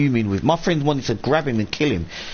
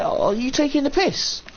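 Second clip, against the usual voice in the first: high, disbelieving squeak; much higher, mock outraged disbelief; incredulous retort.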